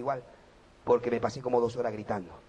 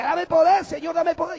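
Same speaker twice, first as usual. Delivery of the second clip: pleading prayer; 14 semitones higher, 10 dB louder, slower than usual. Retold or imitated. Retold pleading prayer continues.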